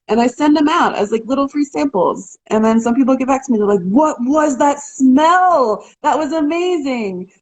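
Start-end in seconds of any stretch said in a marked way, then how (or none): 3.8-7.3 drawn-out, high-pitched amazement; amazed customer. Retold or imitated. Customers getting back to her about free oil samples, amazed. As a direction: stretch it out, higher, imitating a wowed customer.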